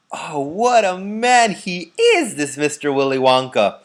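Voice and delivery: put-on old man marvelling at Wonka; loud admiring elderly cry; cried out. A narrator's reading. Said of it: "cried Grandpa Joe".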